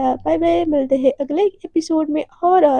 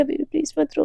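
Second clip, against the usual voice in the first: soft hush; 6 dB quieter, faster than usual. Acted. Devika gently hushing Radhika.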